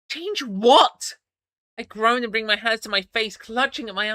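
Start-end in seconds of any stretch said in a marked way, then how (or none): 0.1-1.2 sharp question. A narrator's reading.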